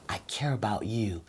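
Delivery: soft whisper, sincere; whispered I care. Shown as a demonstration. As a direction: breathe it gently to your partner. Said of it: "you wanna speak slow".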